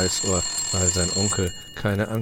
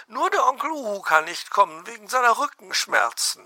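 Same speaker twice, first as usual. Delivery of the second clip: very high, louder bird chatter; chatty magpie; 5 dB louder than usual. Acted. Magpie invites fox to cake, very high chatter. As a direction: rattle off the guest list.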